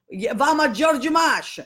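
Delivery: high, loud imitation of grandma; grandmother voice. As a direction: chirp it like grandma heading out shopping. Grandmother voiced naming store.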